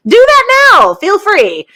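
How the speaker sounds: high, loud push on now; emphatic urging. Real, not acted. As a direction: urge the listeners firmly. Urging listeners to contact Jesus.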